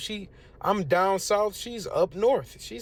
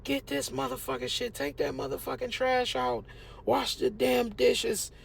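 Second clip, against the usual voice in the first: imitating mom's high-pitched mild mock yell; a higher, half-hearted mock of a mother barking chores; 8 semitones higher, 3 dB quieter than usual. Retelling how his mom used to yell chores, high mock yell. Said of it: "you know, yell".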